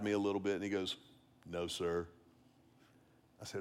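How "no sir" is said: low, very soft admission; imitated sheepish child. Grinning son conceding to his dad, soft and sheepish. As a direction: concede it quietly, as the boy. Boy admits it to his father.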